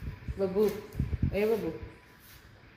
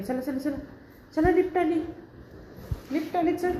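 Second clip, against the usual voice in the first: high, eager calling to the dog; excited calling; 8 semitones higher than usual. Real, not acted. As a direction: coax the dog in brightly. (उत्साहात हाक)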